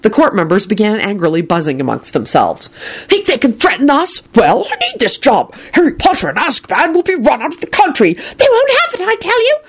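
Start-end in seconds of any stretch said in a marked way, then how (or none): 3.1-9.6 high, hollering official; hollering undersecretary. A narrator's reading. Undersecretary rants at the court, hollering high. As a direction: holler it over the noisy court, pitched up. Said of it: "the undersecretary hollered".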